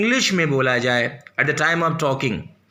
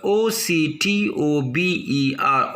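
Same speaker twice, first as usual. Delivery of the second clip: usual speaking voice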